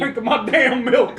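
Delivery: put-on grumpy old man. Mock grumpy complaint.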